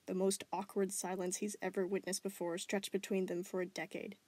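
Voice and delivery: ordinary delivery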